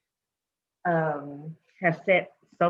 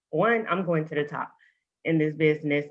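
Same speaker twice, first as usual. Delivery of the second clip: ordinary delivery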